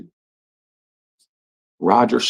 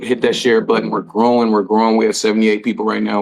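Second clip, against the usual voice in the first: ordinary talk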